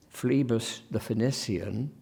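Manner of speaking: PHLEBAS THE PHOENICIAN as "flee-bus the fe-niss-ee-an"; slowly, long ee and crisp S in Phlebas the Phoenician; continued sound. Shown as a demonstration. Lecturer lets listeners hear Phlebas the Phoenician, fe-niss-ee-an. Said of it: "it's important, I think, to hear".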